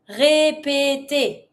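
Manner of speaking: "répéter" slowly, "ré-pé-té"; same vowel thrice. Shown as a demonstration. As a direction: give all three syllables of "répéter" one é sound. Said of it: "Same sound for those three syllables".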